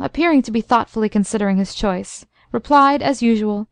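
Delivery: usual speaking voice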